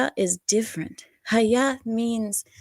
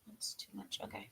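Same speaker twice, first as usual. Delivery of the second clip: whispered aside; 26 dB quieter than usual. Real, not acted. Fixing something, quiet aside.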